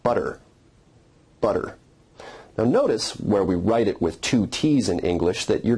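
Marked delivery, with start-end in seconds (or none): 0.0-0.4 "butter" with the tt as a quick tongue flick; tap sound. Shown as a demonstration. Teacher illustrating tap sounds, "butter" with a flapped, d-like tt. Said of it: "hear the tap sound in the word". 1.4-1.8 "butter" with the tt as a quick tongue flick; tap sound. Shown as a demonstration. Teacher illustrating tap sounds, "butter" with a flapped, d-like tt. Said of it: "hear the tap sound in the word".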